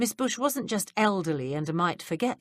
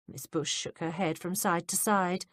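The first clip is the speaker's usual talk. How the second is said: ordinary delivery, nothing marked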